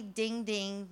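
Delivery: ordinary delivery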